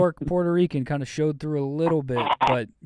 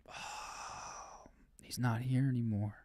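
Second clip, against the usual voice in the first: whisper; 14 dB quieter than usual. Pretend. Jokes about Mister Rocket.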